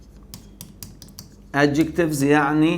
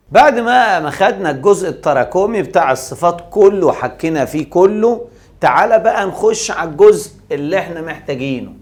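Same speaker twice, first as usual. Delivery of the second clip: louder and higher, rallying the class; energetic raised voice; 4 semitones higher, 7 dB louder than usual. Real, not acted. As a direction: call the class back to attention with energy. Teacher energizes class for new section. (بصوت عالي ومتحمس)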